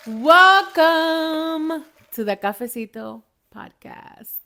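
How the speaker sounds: high, loud, stretched-out show greeting; podcast host's big slow opening; drawn-out welcome. Real, not acted. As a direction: belt out WELCOME slowly, then ease off. Show intro called out.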